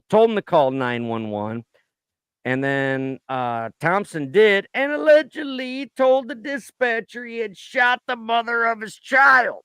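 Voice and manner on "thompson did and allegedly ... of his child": high, loud newsy shout; hammed-up loud news read; mock shouted report. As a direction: belt it out like a tabloid anchor.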